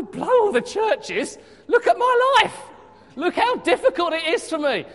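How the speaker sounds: high, loud mock moaning about his lot; whining complaint. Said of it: "I go".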